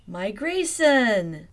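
loud, drawn-out slow call; triumphant cry. Acted. Velma announcing her grand plan, loud and slow. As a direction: stretch it out triumphantly.

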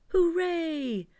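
high, loud cheer; joyful shout. A narrator's reading. Christopher Robin cheering Pooh floating up, loud and high. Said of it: "shouted Christopher Robin".

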